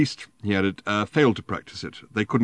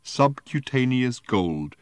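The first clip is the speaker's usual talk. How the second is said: put-on slow, careful drunken word; 5 semitones higher, much slower than usual. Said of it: "he paused for breath".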